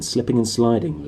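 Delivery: usual speaking voice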